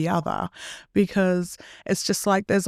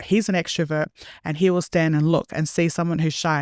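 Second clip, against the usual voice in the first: ordinary talk, nothing marked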